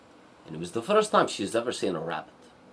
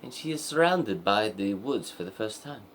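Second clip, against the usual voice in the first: ordinary delivery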